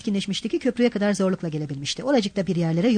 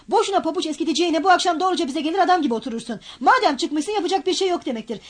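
urgent pleading; 7 semitones higher than usual. Acted. Razumihin urging him to come.

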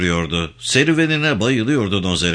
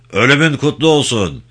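raised, high parade-ground call; military salute; 5 semitones higher, 4 dB louder than usual. A narrator's reading. Commander saluting his assembled guerrillas, calling out. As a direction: call it out to the ranks.

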